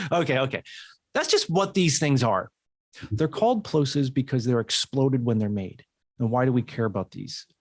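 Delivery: ordinary talk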